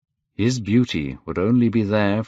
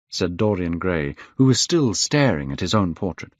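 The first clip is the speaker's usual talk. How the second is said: ordinary delivery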